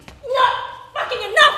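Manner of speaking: high, loud cry through a laugh; desperate cry. Acted. Wife crying out to her dead husband, desperate yell. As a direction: break as you yell it.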